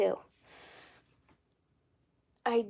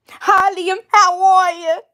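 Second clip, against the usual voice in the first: put-on loud, much higher Alabama farm-girl twang; terrible farm-girl accent; 8 semitones higher, 6 dB louder, slower than usual. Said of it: "My terrible farm girl accent".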